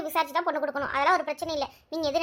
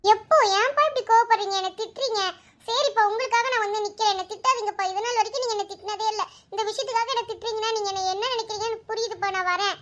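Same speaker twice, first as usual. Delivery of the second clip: higher daughter voice, hurt then giving in; daughter Anu; 5 semitones higher than usual. Acted. Daughter giving in to her scolding father, higher hurt voice. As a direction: sulk back at dad in the higher voice.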